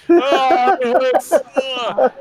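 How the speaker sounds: high, loud groaning complaint; loud, high hurting moan; pained wail. Acted. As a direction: wail about the wound. Wounded thug wailing.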